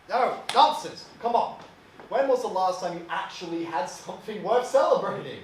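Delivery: loud, high-pitched brushing aside of objections; pushy, worked-up fatherly urging; boisterous insistence. Acted. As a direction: badger your son into celebrating. Father brushes off son's refusal.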